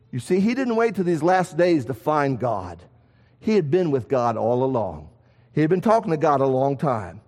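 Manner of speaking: ordinary talk, nothing marked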